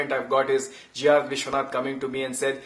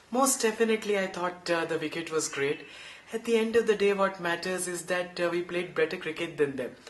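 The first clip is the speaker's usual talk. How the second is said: softer, humble post-match answer; modest Sachin; 2 semitones higher, 5 dB quieter than usual. Retold or imitated. Imitating Sachin modestly answering Tony Greig's question. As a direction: mumble it modestly, playing it down. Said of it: "And then Sachin would go".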